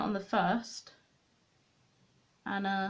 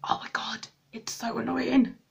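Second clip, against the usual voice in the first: exasperated whisper. Real, not acted. Annoyed at vanishing pencil.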